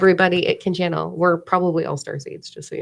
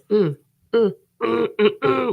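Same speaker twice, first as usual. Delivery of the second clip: rising mmm of pleasure, higher each time; savoring mmm; 4 semitones higher than usual. Pretend. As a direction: savour it aloud, climbing in pitch. Savoring taste buds.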